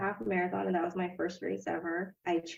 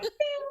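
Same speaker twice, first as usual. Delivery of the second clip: test result ding. Retold or imitated. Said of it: "It was like".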